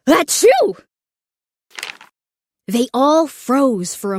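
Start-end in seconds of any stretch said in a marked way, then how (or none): 0.1-0.8 high loud sneeze bursting out; big sneeze. Acted. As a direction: sneeze breaks the sentence. Sneeze cuts off thanks.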